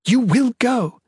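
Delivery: high, emphatic desperate command; forceful, pleading-angry insistence; desperate order. A narrator's reading. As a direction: force him to obey. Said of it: "voice desperate".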